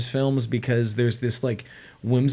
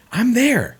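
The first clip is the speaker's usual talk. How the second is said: high-pitched eager cry; excited exclamation; 13 semitones higher, 6 dB louder than usual. Acted. Film fan reacting to an imagined movie pitch, high excited exclamation.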